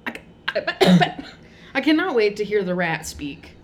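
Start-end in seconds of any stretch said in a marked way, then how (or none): 0.5-1.3 cough. Real, not acted. Cough before speaking.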